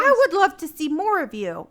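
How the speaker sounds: loud, put-on personal-ad reading; radio reading voice. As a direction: read it out big in your radio voice. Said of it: "Where do you get that voice from".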